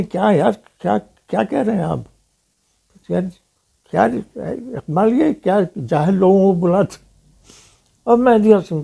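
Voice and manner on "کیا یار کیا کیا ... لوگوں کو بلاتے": raised, quick mock outrage in a higher voice; imitating a flare-up. Singer offended by the praise of another poet, imitated indignant outburst. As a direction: play the offended singer, voice jumping up in disbelief. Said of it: "اب تو جان تو بھڑک گئے پوری طرح".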